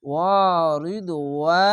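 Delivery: sung Arabic recitation; Quran recitation. Shown as a demonstration. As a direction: recite as if in a mosque, long and full. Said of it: "এক আলিফ টেনে পড়বেন".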